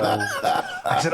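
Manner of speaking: ordinary delivery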